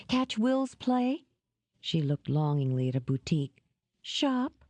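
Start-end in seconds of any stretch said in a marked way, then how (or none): 0.1-1.2 coaxing. A narrator's reading. Dora coaxing Jed. 4.0-4.6 hopeful. A narrator's reading. Dora hopeful.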